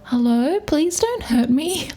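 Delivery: high, small frightened voice; a timid high squeak of a plea; scared plea. Retold or imitated. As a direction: beg not to be hurt, high and slow. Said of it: "It's just this".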